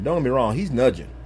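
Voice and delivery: ordinary talk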